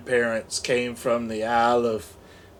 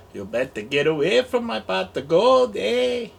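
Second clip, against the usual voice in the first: mock Canadian accent, rising on eh; a light Canadian lilt ending on a high eh; Canadian leprechaun; 5 semitones higher than usual. Leprechaun guarding his pot of gold, mock Canadian accent. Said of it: "That's a Canadian leprechaun".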